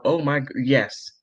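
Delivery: ordinary delivery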